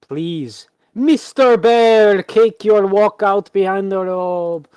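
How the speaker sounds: high, loud, drawn-out showman's announcement; ringmaster's call. Acted. Game master as ringmaster summons the bear on stage, booming showman. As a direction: call out slowly with theatrical flourish.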